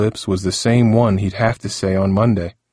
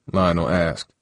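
usual speaking voice

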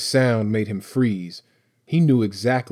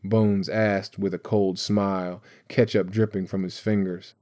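ordinary delivery

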